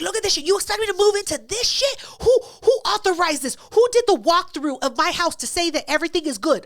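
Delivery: complaining to the rental office in outrage; indignant complaint. Retold or imitated. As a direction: demand answers, outraged. Retold angry complaint to office.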